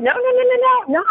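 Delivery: high-pitched rapid string of noes; sing-out high, playful protest; emphatic refusal. Retold or imitated. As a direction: wave the idea away in a high voice. Refusing the fearful thought track.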